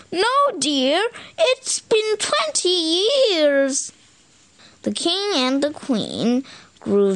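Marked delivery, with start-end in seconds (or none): 0.1-3.9 put-on high, slow, tired old parent; weary parent. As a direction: drag it out like a worn-out mother. Parent answers princess.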